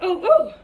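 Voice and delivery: two loud, high re-enacted oohs; hammed-up yelps of sudden pain; mock pain "ooh". Laboring woman as a contraction hits, mock pained oohs.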